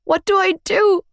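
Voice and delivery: high, desperate sobbing question; harsh crying. Acted. Girlfriend crying to the big man for help, sobbing. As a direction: cry it out helplessly. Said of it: "Another harsh cry escaped me".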